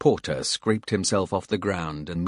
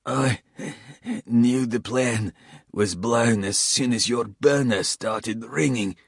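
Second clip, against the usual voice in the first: higher, strained voice of a bleeding traitor; wounded Coles; 5 semitones higher than usual. A narrator's reading. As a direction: spit it out pained and defiant.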